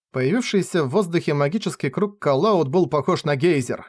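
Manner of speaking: usual speaking voice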